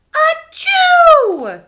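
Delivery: loud high sneeze; big sneeze. Acted. Elephant Gerald sneezing at Piggy, huge sneeze. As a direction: let the sneeze explode.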